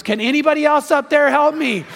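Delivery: high, loud comic plea; hammed-up yell upward; acted scream for help. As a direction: shout past God for other help. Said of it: "the guy screams".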